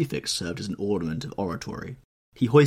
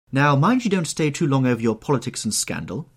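higher, louder call across the room; cried out; 7 semitones higher, 7 dB louder than usual. Acted. Hostess calling to the men from the door, louder. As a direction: call back from the doorway. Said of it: "cried Lady Narborough from the door".

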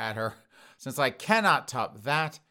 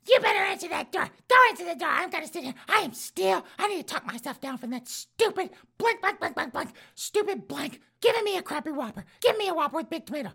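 put-on old woman voice. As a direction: squawk it out like an outraged old lady. Voicing the old woman at home.

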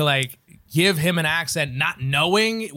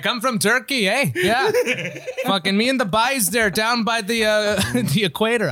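higher-pitched mock Maritime accent, laughing; 6 semitones higher, 3 dB louder than usual. Comic playing his Turkish dad with a silly Maritime accent. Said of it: "a maritime accent".

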